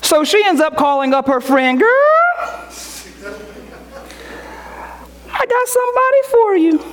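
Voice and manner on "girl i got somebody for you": put-on high, fast gushing girlfriend on the phone; excited girlfriend. Woman calling her friend, high and excited. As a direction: gush the news to your girlfriend.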